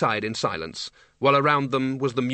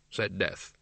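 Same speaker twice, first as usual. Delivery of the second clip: usual speaking voice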